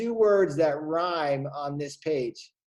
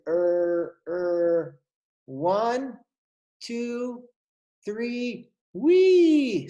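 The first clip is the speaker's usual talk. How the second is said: monkey hesitating; 7 semitones higher, 4 dB louder, much slower than usual. Acted. Monkey stammers then squeals.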